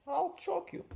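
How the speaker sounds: shrill it up as her, mocking; high mock threat. Mimicking the woman threatening Sharpe, squeaky high. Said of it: "she said".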